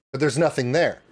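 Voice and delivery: ordinary talk, nothing marked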